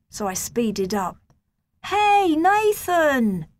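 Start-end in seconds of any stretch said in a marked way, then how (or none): 1.8-3.5 high, loud call across the road; shouting. A narrator's reading. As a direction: shout after a friend to stop him. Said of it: "he shouted".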